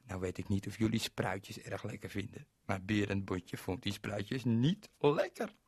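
ordinary delivery, nothing marked

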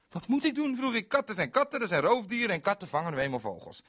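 very high, loud, fast; exasperated explaining; shrill know-it-all; indignant lecture. Acted.

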